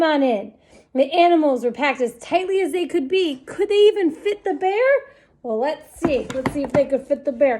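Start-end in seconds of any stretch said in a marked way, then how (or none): none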